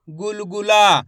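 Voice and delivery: गुलगुला measured out, ending on a held ला; syllable-by-syllable reading. Shown as a demonstration. Teacher blends letters for learners, syllabic गुलगुला. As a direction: sound out गु-ल-गु-ला. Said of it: "एक एक अक्षर का उच्चारण".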